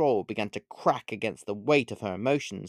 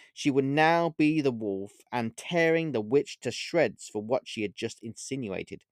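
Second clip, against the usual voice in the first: ordinary delivery, nothing marked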